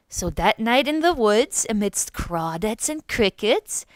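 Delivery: ordinary delivery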